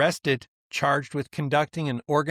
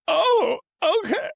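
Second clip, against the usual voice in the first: much higher, crying imitation of his wife; imitating wife crying; 5 dB louder than usual. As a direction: retell his wife's tearful okay, pitched way up and wavering. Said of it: "she's crying".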